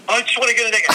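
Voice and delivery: high excited fan voice; eager buyer. Retold or imitated. Buyer shaking money at the seller, high and eager. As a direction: gush like a fan waving cash.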